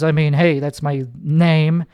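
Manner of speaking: usual speaking voice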